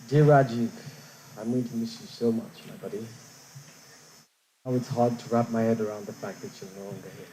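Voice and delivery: choked up, voice fading away; fighting back tears while reading; tearful goodbye. Real, not acted. Reading farewell letter, can't go on.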